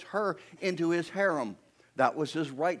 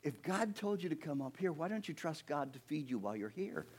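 soft, reasoning wife; quietly voiced challenge; Sarah questioning; 12 dB quieter than usual. Wife questioning husband's trust in God, soft challenge. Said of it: "I imagine Sarah saying".

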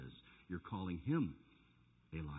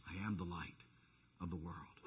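even softer, low reverent repeat; near-hushed echo; 10 semitones lower, 15 dB quieter than usual. Acted. Preacher echoing the verse in a hush to the church. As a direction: let it fade to almost nothing.

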